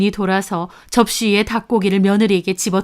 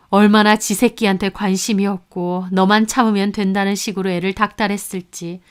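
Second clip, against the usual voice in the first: ordinary delivery, nothing marked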